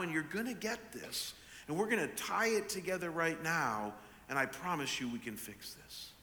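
soft, measured fatherly promise; steady an upset child quietly; calm reassurance. Retold or imitated. Father promising daughter they can fix the shoe, calm reassurance.

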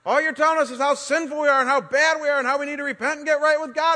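loud, fed-up yell at a preachy friend; angry friends shouting back at the preacher. Retold or imitated. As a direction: bark it like you've had enough of him. Friends yelling while locking him in.

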